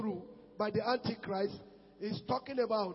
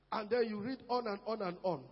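ordinary delivery

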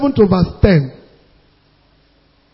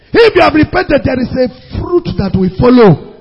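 high, raised declamation; forceful preaching; 5 semitones higher, 4 dB louder than usual. Real, not acted. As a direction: proclaim scripture, stretching the first words, then press it home.

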